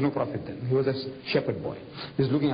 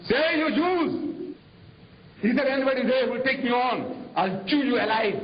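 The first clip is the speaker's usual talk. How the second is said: hammed-up Goliath challenge; mock giant's taunt; 9 semitones higher than usual. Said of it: "this Jalut is shouting".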